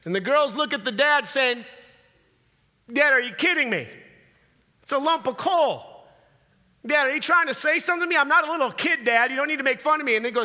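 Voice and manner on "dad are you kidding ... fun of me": mildly indignant, higher-pitched mock daughter; ticked-off daughter. Daughter given a lump of coal to dad, mock indignation. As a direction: protest with acted disbelief. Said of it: "all the girls are ticked off".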